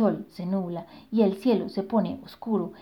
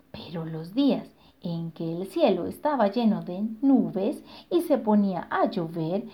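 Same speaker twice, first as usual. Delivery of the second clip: ordinary delivery, nothing marked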